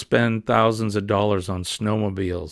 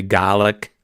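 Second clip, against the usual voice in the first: garlic in a Maine accent, no r, gah-lick, said louder; 5 dB louder than usual. Shown as a demonstration. Vlogger showing viewers the Maine way of saying garlic, Maine accent. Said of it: "as we say".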